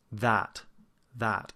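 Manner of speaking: "that" said alone with a full ah as in cat; stressed "that", full open a; open ah vowel. Shown as a demonstration. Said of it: "And that's the ah sound".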